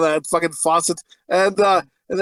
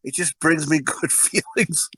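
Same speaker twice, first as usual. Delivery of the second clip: ordinary talk, nothing marked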